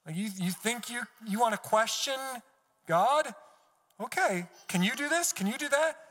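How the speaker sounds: high, incredulous mocking questions; sarcastic. God paraphrased, answering whoever questions him, sarcastic. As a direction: dare them, pitch climbing with each question. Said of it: "God's getting sarcastic here".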